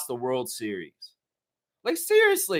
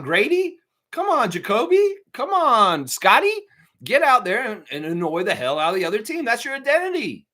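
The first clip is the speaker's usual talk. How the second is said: ordinary talk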